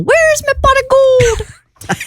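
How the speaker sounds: high, slow stage-Irish cry; hammed-up Irish, stretched out; Irish accent yell. Shown as a demonstration. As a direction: call it out like a leprechaun. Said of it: "you must yell".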